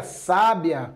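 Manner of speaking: sábia, stressed on the opening SÁ; stress on first syllable. Shown as a demonstration. Teacher showing students how accent changes a word, stress on first syllable. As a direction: hit SÁ in sábia, let the rest fall away. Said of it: "se eu quero aqui que o meu".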